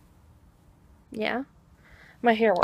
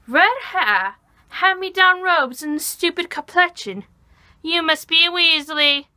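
put-on Star Kid Draco; 4 semitones higher, 5 dB louder than usual. Cosplayer performing Draco sizing up a Weasley, hammed-up and mocking. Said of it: "Star Kid Draco, by the way".

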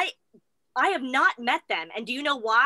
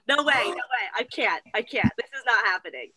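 ordinary talk

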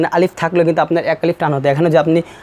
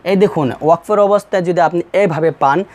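ordinary delivery

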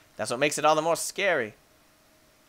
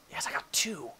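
soft triumphant whisper; gleeful whisper; 6 dB quieter than usual. Real, not acted. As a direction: whisper it to yourself. Quiet triumph.

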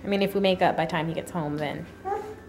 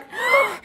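playful gasp; 3 dB louder than usual. Real, not acted. Mother distracts crying baby.

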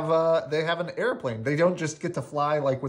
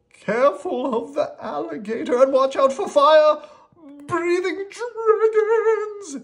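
very high, loud frightened warning; high frantic character shout; panicked yell; 8 dB louder than usual. Acted. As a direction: yell out, wailing on the last word. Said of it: "yelled Rocky".

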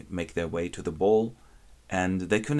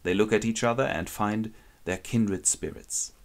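usual speaking voice

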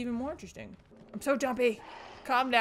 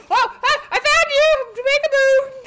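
high, loud gleeful cry; triumphant discovery; excited shout; 9 semitones higher, 4 dB louder than usual. Real, not acted. Gamer finding the hidden enemy, excited shout.

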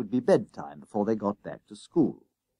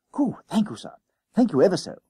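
put-on high, delighted boy gushing thanks; giddy schoolboy thanks for five pence; excited schoolboy thanks; 8 semitones higher, 4 dB louder, faster than usual. A narrator's reading. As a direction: hop with glee as you thank him.

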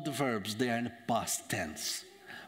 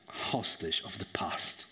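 ordinary delivery

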